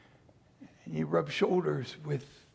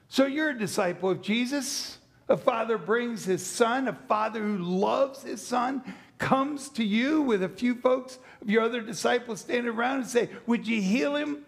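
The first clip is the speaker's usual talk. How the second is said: animated storytelling; 7 semitones higher, 4 dB louder than usual. Acted. Acts out the scene, raised.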